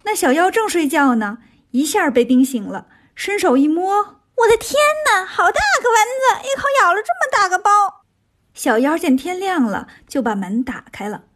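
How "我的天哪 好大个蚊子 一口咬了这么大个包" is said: high, squeaky-pitched whining minion; startled little creature complaining at high pitch; little demon, alarmed. Acted. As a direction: wail in a high put-on voice, speeding up. Little demon wakes stung by mosquito.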